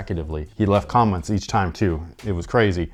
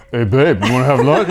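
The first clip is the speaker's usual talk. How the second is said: mocking imitation; 2 semitones higher, 6 dB louder than usual. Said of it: "she'll be like".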